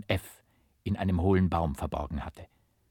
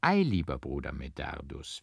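suddenly serious; 6 semitones lower than usual. A narrator's reading. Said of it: "fing er plötzlich sehr ernst an".

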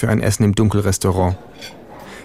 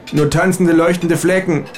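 calling out; 8 semitones higher than usual. A narrator's reading. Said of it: "rufe ich".

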